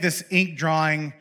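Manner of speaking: normal talking voice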